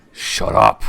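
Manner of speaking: loud, low, rasped order; harsh snap. Acted. Boss snapping at his partner, loud harsh order. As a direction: bark it to silence him.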